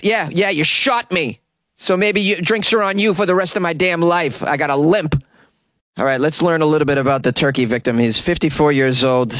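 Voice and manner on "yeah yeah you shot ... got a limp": wounded buddy complaining. Retold or imitated. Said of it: "Be like".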